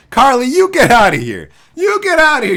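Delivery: loud, high playful yell; yelling at wife to get out; shout at wife. Real, not acted. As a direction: shoo her out loudly.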